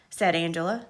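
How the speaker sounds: plain reading voice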